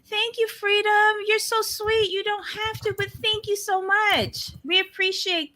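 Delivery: high-pitched, sweet gushing thank-you. Real, not acted. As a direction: thank them in a high, sweet sing-song. Thanking a supporter effusively.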